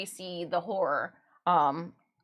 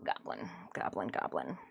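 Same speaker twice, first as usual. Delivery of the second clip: low, whispered goblin croak; 5 semitones lower, 4 dB quieter than usual. Pretend. Answering Goblin Slayer as a goblin, low whispered croak. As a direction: hiss it like a gleeful little goblin. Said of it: "I'd be like".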